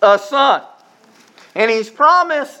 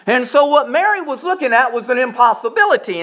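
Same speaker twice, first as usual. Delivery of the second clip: normal talking voice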